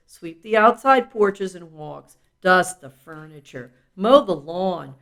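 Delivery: usual speaking voice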